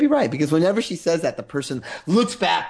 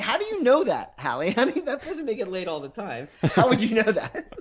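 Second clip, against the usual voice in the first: laughing protest; 7 semitones higher than usual. Retold or imitated. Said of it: "And I'm like".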